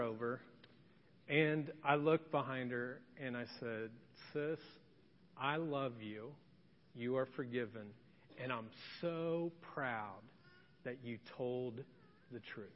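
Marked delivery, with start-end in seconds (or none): 4.3-12.7 tender father. Retold or imitated. Father forgiving crying daughter.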